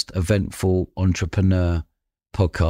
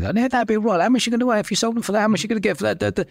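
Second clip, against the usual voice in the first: much higher, put-on voice for the dealers' pitch; imitating the lads' shady pitch, high and quick; mock dodgy dealer voice; 12 semitones higher, faster than usual. Podcaster mimicking the pranksters pitching drugs, mock dealer voice.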